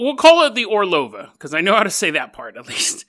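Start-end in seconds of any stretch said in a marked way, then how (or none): none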